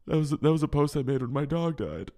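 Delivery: half-hearted tearful excuse; mock breaking down. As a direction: plead as if breaking down, lightly acted. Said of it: "also breaking down".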